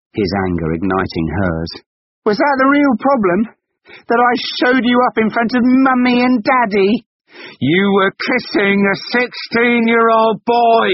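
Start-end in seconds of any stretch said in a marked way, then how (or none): 2.2-10.9 very high, loud yelled accusations; furious accusation. Acted. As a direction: throw the blame at her, full volume. Husband accusing in a quarrel.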